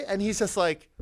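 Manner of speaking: normal talking voice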